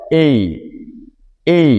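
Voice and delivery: এই said as a clear two-part glide, e then i; letter এই with a light i at the end; teaching pronunciation. Shown as a demonstration. Teacher to students, pronouncing এই slowly and clearly. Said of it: "উচ্চারণটা হচ্ছে এই".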